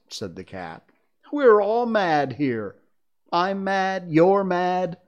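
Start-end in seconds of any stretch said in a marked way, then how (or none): 1.3-5.0 loud, slow and gleeful cat; gleeful mad cat. Acted. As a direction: relish every mad. The Cheshire Cat voiced in story.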